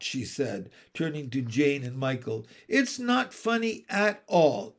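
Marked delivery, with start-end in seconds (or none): 2.7-4.7 high, firm correction; stern reproof. Acted. Nanny correcting Jane and Michael, stern reproof. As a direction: reprove them with offended dignity.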